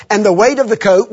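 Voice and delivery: ordinary delivery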